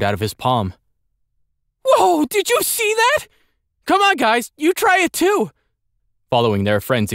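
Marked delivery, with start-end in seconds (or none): 1.8-5.5 put-on high, excited boy voice; excited young boy. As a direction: pitch way up, bursting with excitement. Andel thrilled at lightning.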